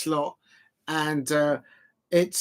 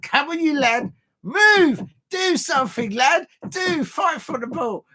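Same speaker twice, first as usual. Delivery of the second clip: much higher, loud Scouse fan urging the lads on; mock Scouse accent; 12 semitones higher, 3 dB louder than usual. Vlogger playing a Liverpool fan yelling at the players, in an exaggerated Scouse accent. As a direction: bark at the players like a Scouse fan in the stands, pitched way up. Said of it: "That's what they say".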